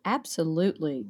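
ordinary talk, nothing marked

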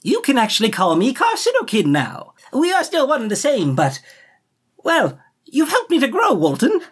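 high, bright little creature voice; cheery crab voice. Acted. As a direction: chipper cartoon sidekick. Crab companion answers.

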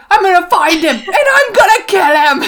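high, loud hammed-up vow of revenge; mock furious Harry. Podcaster mocking Harry's revenge vow, hammed-up and loud. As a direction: overact a teen swearing vengeance. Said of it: "Harry's like".

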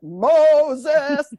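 a hint of booming movie God; lightly voiced loud movie-prophet call; Charlton Heston. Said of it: "like Charlton Heston".

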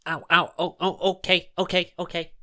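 high, yelping protest; acted pain. Jasper with arm twisted behind back, pained yelps. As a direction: yelp as if your arm is being twisted.

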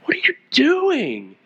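high, slow, exasperated parent's demand; frustrated parent. Acted. God imagined scolding Elijah, frustrated parent. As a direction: drag it out high like a fed-up parent. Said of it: "like that frustrated parent".

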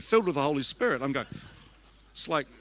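ordinary delivery

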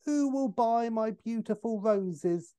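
high, slow Cockney flower-seller's cry; Cockney accent. Acted. Corpse sits up and says, in hammed-up Cockney. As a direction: sing out a hammed-up Cockney street call, drawn out. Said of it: "says in an astonishing Cockney accent".

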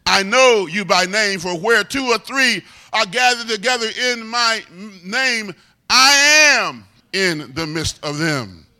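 loud, rolling preacher's cadence; loud, swelling preaching climax; full-force preaching. Acted. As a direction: preach it to the congregation.

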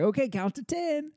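high, eager child imitation; giddy toddler. Daughter to dad in hide and seek, giddy. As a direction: pitch it up like an excited little kid. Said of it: "she's so giddy".

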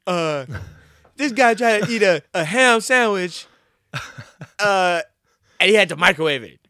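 put-on high, loud taunting kid; hammed-up kid humiliating his sibling; imitating a taunting kid; mocking kid voice. Kid mocking other kid on camera.

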